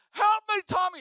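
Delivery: high, loud cry for help; screaming at the top. Retold or imitated. Boy grabbed by dog begs friend, high frantic scream. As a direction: scream desperately for rescue, hammed-up. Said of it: "I'm screaming at the top".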